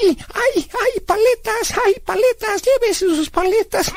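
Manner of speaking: very high, drawn-out popsicle seller's cry; mock ugly street cry; imitated street vendor. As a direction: hawk popsicles in a squealing kid voice. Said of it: "Gritaba muy feo, gritaba".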